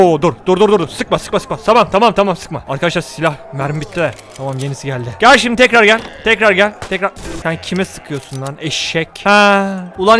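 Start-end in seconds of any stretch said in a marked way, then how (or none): none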